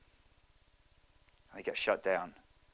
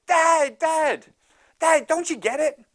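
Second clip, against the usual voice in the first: put-on high, loud eager kid; excited boy; 13 semitones higher, 7 dB louder than usual. Optimistic boy tells his dad about the pony, high and excited. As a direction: bubble over like a thrilled little boy. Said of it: "He said, he said".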